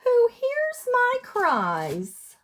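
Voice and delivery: usual speaking voice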